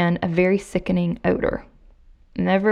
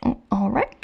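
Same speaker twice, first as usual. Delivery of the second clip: nervous; 5 semitones higher, 4 dB quieter than usual. Retold or imitated. Robert backing off scared.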